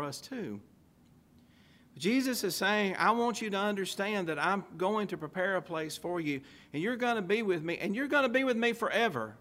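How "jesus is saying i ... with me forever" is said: building sermon. Acted. Preaching builds in force.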